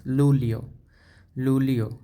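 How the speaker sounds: লুলিও as lu-li-o, starting with l; Italian Luglio. Shown as a demonstration. Teacher shows July's different Italian sound. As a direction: model লুলিও, l not j. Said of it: "উচ্চারণটা একটু difference".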